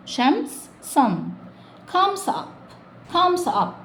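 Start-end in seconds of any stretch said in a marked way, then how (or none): 1.9-3.7 high drill. Shown as a demonstration. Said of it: "high rising drill".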